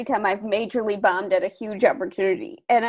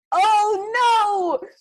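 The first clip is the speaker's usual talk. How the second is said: mock dismay; 12 semitones higher, 4 dB louder than usual. His reaction to the audience's "aw.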